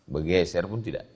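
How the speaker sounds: ordinary talk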